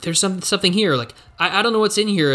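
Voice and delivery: high, urgent discovery; excited character. Acted. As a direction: blurt it out, high and eager. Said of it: "they're like".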